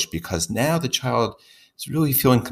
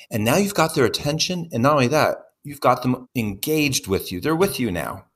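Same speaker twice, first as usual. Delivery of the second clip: ordinary talk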